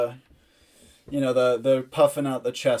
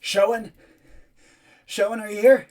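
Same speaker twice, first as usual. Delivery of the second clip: raised, higher voice calling for a friend; half-yell into the dark, pitched up; 9 semitones higher, 8 dB louder than usual. Acted. Boy yells up to his hidden friends, calling out. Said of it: "And he yells out".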